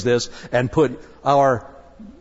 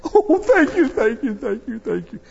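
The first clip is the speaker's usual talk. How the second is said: overjoyed thanks; 6 semitones higher than usual. Retold or imitated. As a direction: thank him over and over, then fade. Forgiven debtor thanks creditor.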